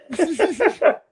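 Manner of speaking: high burst of laughter. Real, not acted. Laughs at the quote.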